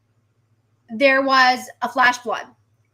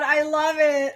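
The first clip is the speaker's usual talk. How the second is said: normal talking voice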